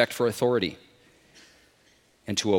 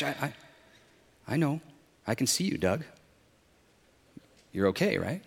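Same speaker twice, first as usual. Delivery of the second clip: soft, unhurried voice from above; calm reassurance; 9 dB quieter than usual. A narrator's reading. The Lord reassures man on the branch, soft and calm. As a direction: reassure him quietly. God calmly reassures stranded Doug.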